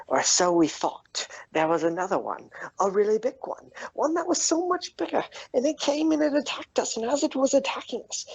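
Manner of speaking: climbing to a very high, frantic pitch; excited tale. Acted. Bullywug tells Sin of the monster attack, worked up.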